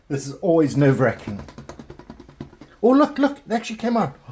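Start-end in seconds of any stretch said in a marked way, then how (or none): 2.8-4.2 high, loud thrilled cry; excited. Real, not acted. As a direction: burst out in delight. Bread comes out of tin.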